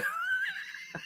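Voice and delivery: very high squeal of a laugh; real startled laugh-shriek. Real, not acted. Shocked by donation.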